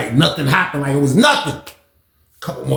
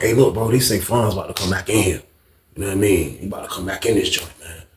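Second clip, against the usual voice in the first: hushed warning; 8 semitones lower, 7 dB quieter than usual. Retold or imitated. Warning cellmate quietly.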